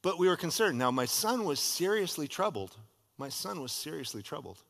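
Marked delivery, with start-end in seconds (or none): 3.2-4.6 much softer, grave repetition; quiet. Shown as a demonstration. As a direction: drop it to a hushed echo. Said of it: "repeated softly".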